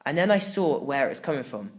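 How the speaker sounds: usual speaking voice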